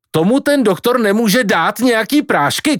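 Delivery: very high, agitated outburst; shouting in protest. Acted. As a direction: argue it up high, as a mild shout. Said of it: "křičel Kvido". (rozčilené pokřikování na matku)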